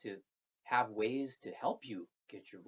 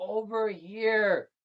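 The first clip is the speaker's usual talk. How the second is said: higher, louder, slow call; plant spirit calling; 8 semitones higher, 8 dB louder, much slower than usual. Acted. Fern spirit lures the girl, high and slow. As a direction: draw it out slowly to lure her.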